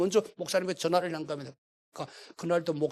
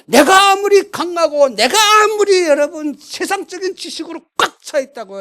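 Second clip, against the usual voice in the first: much higher and much louder, full preaching force; preaching climax; 11 semitones higher, 16 dB louder than usual. Real, not acted. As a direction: hammer the point home to the congregation. Preacher climaxing sermon point.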